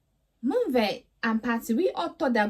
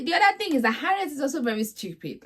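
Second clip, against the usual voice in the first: usual speaking voice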